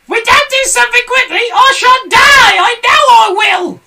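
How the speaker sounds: very high, loud wailing hag; Mrs Twit screaming. A narrator's reading. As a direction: scream that she will die. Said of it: "screamed Missus Twit".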